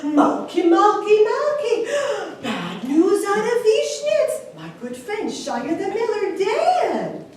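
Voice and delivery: urgent, high call to his wife; alarmed calling out. A narrator's reading. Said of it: "He called for his wife".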